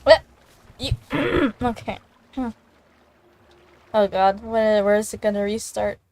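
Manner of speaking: ordinary delivery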